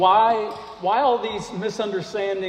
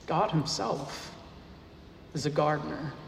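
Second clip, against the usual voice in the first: hushed emphasis; 3 semitones lower, 10 dB quieter than usual. Acted. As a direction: draw them in by dropping the voice. Pastor repeats key claim, hushed.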